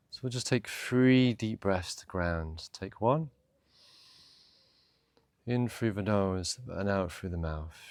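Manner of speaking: calm, unhurried meditation voice with long pauses; guided meditation. Acted. Meditation teacher guiding listeners through breath and boat journey, calm.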